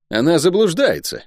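high, urgent protest; impassioned contradiction; heated objection. A narrator's reading. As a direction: object hotly, pitch leaping up. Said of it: "горячо возразил Рандо".

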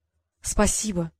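slow, crushed little thank-you. A narrator's reading. Girl caught out by her father thanks him, crushed. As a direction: say it as if all hope is gone. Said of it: "сказала она убитым голосом".

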